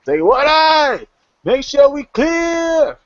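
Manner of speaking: very high, long-drawn holler; stretched, high-pitched yell; dock worker yelling up. Acted. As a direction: call upward through cupped hands. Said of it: "he yells out".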